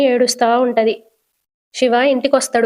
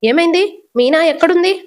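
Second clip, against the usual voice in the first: higher, louder panicked question; worried mother on the phone, alarmed and raised; anxious; 6 semitones higher, 3 dB louder than usual. Acted. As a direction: ask in a sudden fright for her daughter. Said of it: "కంగారు పడుతూ".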